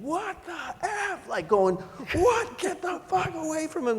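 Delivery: very high, drawn-out imitated scream; retold screaming. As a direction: shriek it like a furious little roommate.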